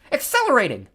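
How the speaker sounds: high, loud alarmed cry. A narrator's reading. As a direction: cry out the warning. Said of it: "Tempest cried".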